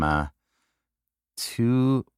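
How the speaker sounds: ordinary delivery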